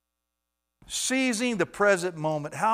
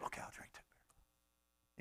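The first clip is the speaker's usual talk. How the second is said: sheepish whisper; whispered giving in; 23 dB quieter, slower than usual. Retold or imitated. Man caving to his friend's pressure, whispered. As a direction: cave in under your breath.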